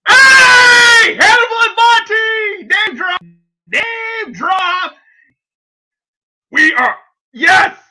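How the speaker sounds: high, loud thrilled yelling at a name drop; excited shout. Real, not acted.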